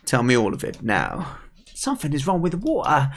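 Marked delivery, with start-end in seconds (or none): 1.7-3.1 high, put-on kid voice; worried young character. Kid telling the pike about the water, worried. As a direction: report the trouble in a high character voice.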